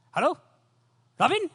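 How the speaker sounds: loud, high, fast Tamil-English phone chatter; Sri Lankan uncle on the phone. Retold or imitated. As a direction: play an excited uncle rattling on down the line. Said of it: "it's like broken Tamil and English together".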